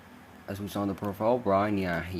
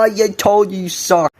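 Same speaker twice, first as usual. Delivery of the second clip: taunting; 8 semitones higher, 3 dB louder than usual. Real, not acted. Taunting rival.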